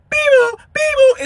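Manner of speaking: high, drawn-out beeping alarm noise; imitating car alarm. Narrator copying his Tesla's frantic alarm beep. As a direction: mimic the Tesla screen beeping in panic.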